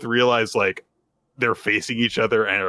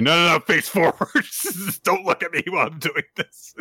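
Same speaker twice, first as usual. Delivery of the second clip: higher flustered protest broken by a laugh; lightly acted panicky insistence, pitched up; mock flustered; 6 semitones higher than usual. Podcaster voicing a girl telling him face forward, mock flustered. Retold flustered order not to look.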